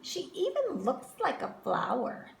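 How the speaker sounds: put-on high, superior little girl; smug girl. A narrator's reading. Victoria boasting to the class, high and smug. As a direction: lift your nose and boast.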